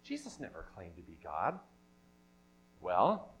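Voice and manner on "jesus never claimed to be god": very quiet, low aside; hushed, low-pitched quoting; dismissive quote. Acted. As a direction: voice the doubters low and under the breath. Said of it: "some people who say".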